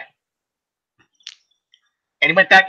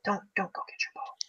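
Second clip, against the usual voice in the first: breathless whisper, much softer; whispered warning; 17 dB quieter than usual. Real, not acted. Warning golfers in Florida about their ball, whispered. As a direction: hiss it like a secret warning.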